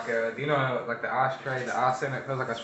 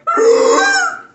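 very loud, high squealing outburst; piercing high yelp; high-pitched squeal; 16 dB louder than usual. Real, not acted. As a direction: squeal loudly from the burn. Friend reacting to burning chip.

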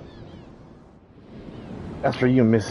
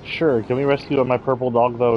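ordinary delivery

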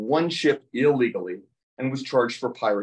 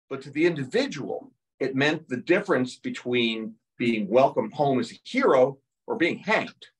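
ordinary delivery

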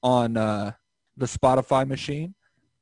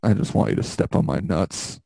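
deep mocking impression of a friend; imitating Brandon's low voice; 7 semitones lower than usual. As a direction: drop low, play him pathetic. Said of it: "Brandon, you're just like".

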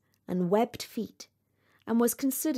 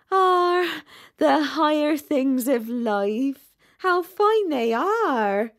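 slow, loud, higher duck voice; sighing duck; 5 semitones higher, 6 dB louder, much slower than usual. A narrator's reading. Duck echoes rocket on higher things, slow gushing admiration. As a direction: draw out each word in swooping admiration.

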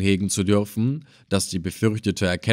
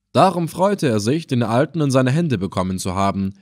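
plain reading voice

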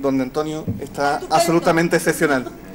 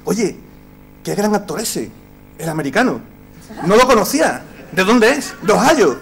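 quoting a spectator; 5 semitones higher, 7 dB louder than usual. Retold or imitated. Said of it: "un espectador me preguntó".

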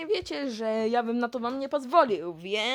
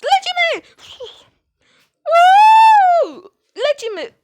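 whooping; 15 dB louder than usual. Acted. Riding the slide.

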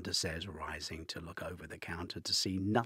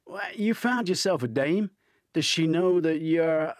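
Hunter's voice, ribbing; 11 semitones higher, 11 dB louder than usual. A narrator's reading.

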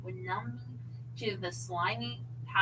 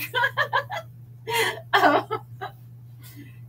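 laughing; 14 semitones higher, 5 dB louder than usual. Real, not acted.

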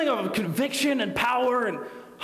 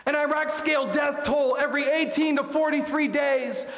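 higher and rising, hammering each number; building preacher delivery; 6 semitones higher than usual. Acted. Preacher pressing the congregation with child death figures, building. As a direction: preach the figures with mounting urgency.

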